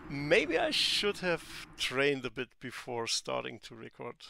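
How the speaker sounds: normal talking voice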